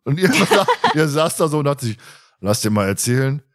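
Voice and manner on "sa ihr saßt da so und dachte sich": ordinary delivery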